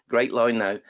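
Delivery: normal talking voice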